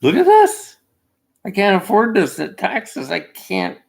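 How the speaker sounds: high, loud mock complaint at the start; hammed-up taxpayer grumbling; complaining voter. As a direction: whine it to your MP. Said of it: "go to your M P and complain".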